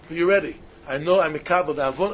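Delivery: ordinary delivery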